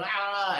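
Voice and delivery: a patient's howl, imitated lightly; retold scream. Hospital patient howling at the nurses, mock scream. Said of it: "one of them who was screaming".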